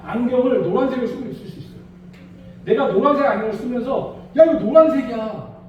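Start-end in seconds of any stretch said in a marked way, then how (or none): 4.4-5.6 insistent claim. Retold or imitated. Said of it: "막 이러면".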